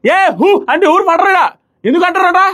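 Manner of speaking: comic mockery. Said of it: "Ritu బాగా comedy".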